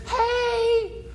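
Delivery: high, soft, flirty hey; flirty lady voice. Retold or imitated. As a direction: lure a married man with one word. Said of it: "a lady comes up to a man".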